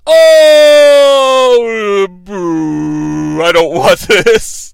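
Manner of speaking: mock crowd moan; booing crowd. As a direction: groan and boo in drawn-out disappointment. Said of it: "They initially are like".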